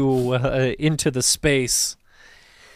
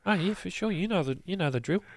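high, soft, quick bouncer; easygoing doorman, pitched up; Bark's voice; 6 semitones higher, 8 dB quieter, faster than usual. Acted. GM as Bark waving them in, high and breezy.

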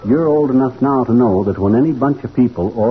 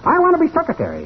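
much higher, fast piping bird voice; piping little cuckoo; 14 semitones higher, much faster than usual. Acted. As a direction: pipe up like an eager little bird. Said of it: "the little cuckoo piped up".

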